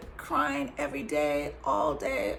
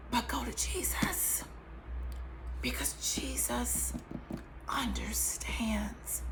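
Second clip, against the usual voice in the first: slow, hushed, tender; reverent whisper; 7 dB quieter, slower than usual. Real, not acted. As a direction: whisper it, slowing to the end. Reverent closing urge.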